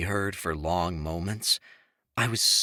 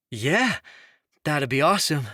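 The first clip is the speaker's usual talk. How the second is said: flustered; 7 semitones higher, 5 dB louder than usual. A narrator's reading. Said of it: "stumbling around his words".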